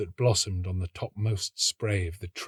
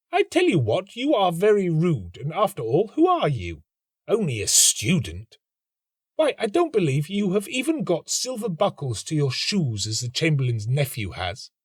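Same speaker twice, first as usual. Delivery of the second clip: much higher, scornful retort; scornful girl; 5 semitones higher than usual. A narrator's reading. The professor's daughter scorning the student, high scornful voice. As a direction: belittle him. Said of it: "girl retorts angrily".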